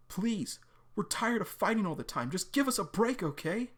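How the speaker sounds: Sarah begging John for a break, imploring; pleading. Acted. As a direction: beg him, voice lifted. Sarah begs John to stop.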